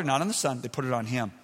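ordinary talk

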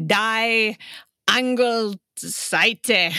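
very slow mock-serious German; German word. Shown as a demonstration. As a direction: drag out Die Angelseite gravely. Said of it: "You have to be very serious".

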